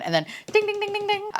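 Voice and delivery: high singing ding ding; imitating phone notification chimes; sing-song dings.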